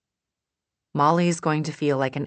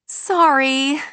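high apologetic; 12 semitones higher, 7 dB louder than usual. A narrator's reading. May voiced, high apology.

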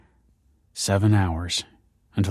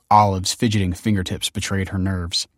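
ordinary delivery, nothing marked

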